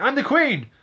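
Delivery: high, loud outraged protest; hammed-up imperious yell; indignant outcry. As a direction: cry out in affronted royal disbelief. Acts out queen being arrested.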